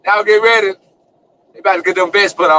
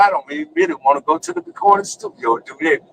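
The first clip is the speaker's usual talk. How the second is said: ordinary talk, nothing marked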